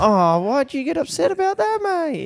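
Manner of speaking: high, lightly mocking ocker voice; a hint of a dismissive bloke; mock innocent Aussie shrug. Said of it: "they're like".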